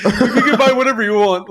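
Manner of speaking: high-pitched, laughing self-parody; mock melodrama. Dramatic child offering belongings to little sister, mock melodrama.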